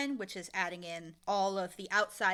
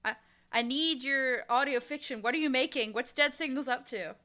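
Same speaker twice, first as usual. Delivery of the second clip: louder, half-joking call to the missing team; mock plea; 5 semitones higher, 8 dB louder than usual. Fan calling out to the silent Dead Signals team, loud mock plea. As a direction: call out as if they could hear you. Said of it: "I'm like".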